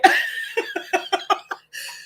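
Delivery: high, breath-driven chuckling; laughter. Real, not acted. Grandmother amused by her own school questions, laughing. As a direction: break into real high giggles mid-story.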